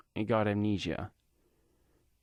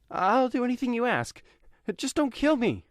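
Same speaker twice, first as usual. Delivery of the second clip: very high, loud, terrified plea; desperate begging; 5 dB louder than usual. Acted. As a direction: beg like the gun is at your head. Kidnapper begging at gunpoint.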